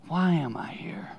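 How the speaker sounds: slow, breathy whisper of a lonely question. Acted. Preacher voicing Jordan's secret night thought, whispered. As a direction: whisper it as if to himself.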